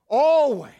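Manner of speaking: "Always" called out loud and high; "Always" shouted high and falling. Shown as a demonstration. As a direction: belt out "Always" as a call to the crowd. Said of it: "Say that word with me".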